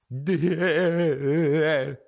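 high, drawn-out mock giggle; imitated laugh. Buddy's response to being scolded, imitated goofy giggle. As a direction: do the friend's silly giggle. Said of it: "That's what he would do".

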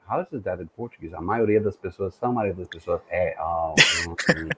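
quick, soft muttering to himself. Retold or imitated. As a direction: mumble it fast under your breath, puzzling it out. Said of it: "and say".

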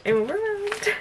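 high, squeaky repeat; small high-pitched wail of delight; overwhelmed squeal. Real, not acted. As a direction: let the voice climb very high, overcome.

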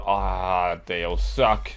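ordinary talk, nothing marked

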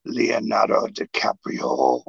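slow, low imitated announcement; drawn-out name reveal. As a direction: stretch the name out for effect.

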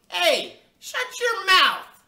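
put-on loud, high kid's holler; bossy, very loud high yell. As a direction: holler it at full blast, drawn out. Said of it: "I hollered".

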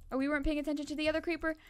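usual speaking voice

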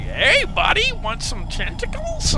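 high mock friendly offer, slowing down; a hammed-up Slender Man being chummy; imitating monster. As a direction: play the creepy friend offering a treat. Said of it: "he's like".

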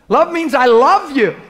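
slow, loud and high, every word pressed; emphatic declaration. Real, not acted. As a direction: land it like the heart of the sermon. Preacher declares love's meaning.

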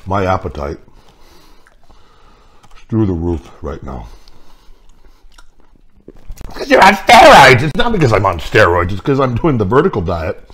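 chewing between words; eating while talking; mouth full of food. Real, not acted. Seen 0: bites food at -7, chewing mouth shapes while speaking; refs no food.

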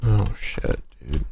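normal talking voice

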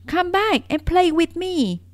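high, loud calling; calling after. Acted. Little rabbit calls after the vanished rabbits, high and loud. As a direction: call out after the fleeing rabbits. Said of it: "called the little rabbit".

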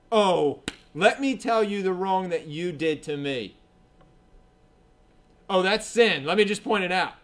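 loud, smug finger-pointing; mock the know-it-all scold; a preachy accuser; self-righteous rebuke. Mocking an arrogant rebuker.

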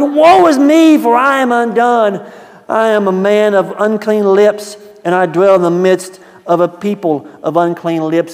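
high, loud woe is me; anguished cry. Acted. Isaiah before the Lord, high loud anguish. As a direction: cry out undone before God. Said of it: "And so I said".

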